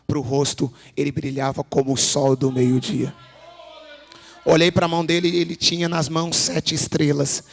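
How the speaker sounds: preacher booming the Revelation scene; fiery scripture preaching. Acted. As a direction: thunder out John's vision. Preaching vision at full force.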